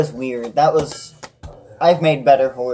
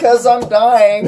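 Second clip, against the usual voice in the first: laughing; 8 semitones higher, 6 dB louder, much slower than usual. Real, not acted. Laughing fit over card game.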